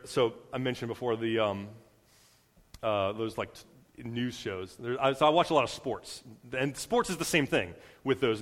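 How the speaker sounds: usual speaking voice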